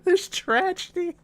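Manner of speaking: high, giggling through the words; can't keep a straight face about a tragedy; laughing. Real, not acted. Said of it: "Why are you laughing at this?".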